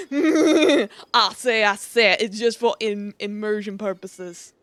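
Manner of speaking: lazy Southern drawl, repeating the line as a cowboy. Shown as a demonstration. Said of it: "start talking in a southern drawl".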